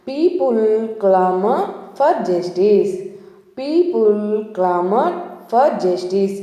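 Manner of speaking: very slow, word by word; slow example sentence. Shown as a demonstration. Teacher reads the example sentence very slowly for students. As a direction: read it out slowly so learners can follow. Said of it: "sentence ద్వారా".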